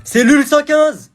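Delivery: slow, loud, high call of the cell number; guard calling out. Acted. Guard calling the next inmate, loud and slow.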